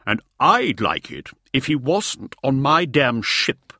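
loud, grumbling complaint. A narrator's reading. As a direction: gripe it out, fed up. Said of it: "grumbled Ogvai".

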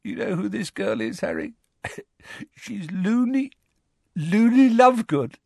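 high, delirious, laughing confidence; weakly giggling. A narrator's reading. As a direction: break into helpless titters while talking. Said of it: "still giggling weakly".

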